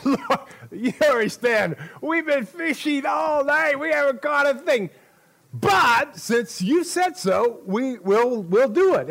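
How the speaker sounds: high, loud protest easing into consent; exasperated protest. Acted. Peter objects to Jesus after fishing all night, loud exasperation. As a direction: raise your voice high, stretching the big words.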